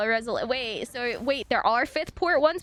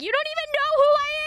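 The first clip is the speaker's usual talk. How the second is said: high-pitched, rushed indignant protest; incredulous squeal at a clueless haggler; indignant retort; 14 semitones higher, 5 dB louder, much faster than usual. Retold or imitated. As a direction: fire back in mock outrage, voice climbing high.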